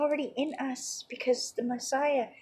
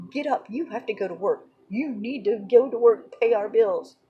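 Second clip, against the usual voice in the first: fast, louder at the end, hurrying him to work; pushy nagging; 6 dB louder than usual. Retold or imitated. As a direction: nag him off the couch, rising to louder. Someone pulling on you, pushing.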